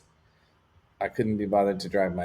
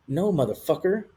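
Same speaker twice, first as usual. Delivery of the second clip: a sharp, higher retold cuss; cussing him out; 7 semitones higher than usual. Frustrated teen cussing at the first responder, sharply raised. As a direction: snap back at the cop, half reliving it. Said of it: "I cussed at him".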